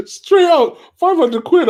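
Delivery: high and loud, hyped up; excited shout. Real, not acted. Streamer hyped by a big chat donation, high excited shout. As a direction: shout the price like you can't believe it.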